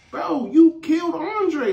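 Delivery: high, slow acted accusation; accusing. Emma telling Sam he killed Andre, accusing. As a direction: drag out the shocked accusation up high.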